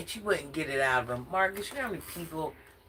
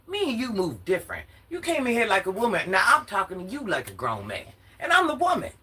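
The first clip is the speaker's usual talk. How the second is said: higher and louder, exasperated; exasperated woman scolding Marcus, higher and louder; heated rant; 7 semitones higher, 3 dB louder than usual. Real, not acted. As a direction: let it build into a fired-up scold.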